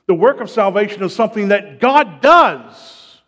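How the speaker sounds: preacher declaring it with rising force; full-force preaching. Real, not acted. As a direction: hammer out the teaching point emphatically.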